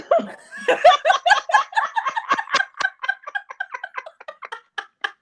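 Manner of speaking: long high burst of helpless laughter; a real crack-up, high and long; big real laugh. Real, not acted. Host cracks up at the joke.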